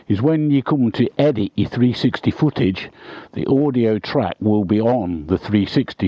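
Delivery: ordinary delivery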